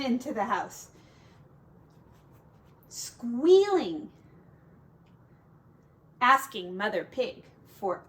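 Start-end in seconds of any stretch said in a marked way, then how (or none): none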